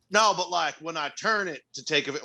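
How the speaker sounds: dad voiced with a Southern twang; Southern accent. Dad calling back about his phone, Southern accent. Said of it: "giving my dad a Southern accent".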